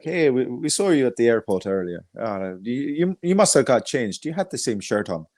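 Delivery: lightly put-on Northern Irish accent, imitating a fan; a hammy, half-hearted go at a Belfast voice; bad Belfast accent. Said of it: "That was fucking bad accent".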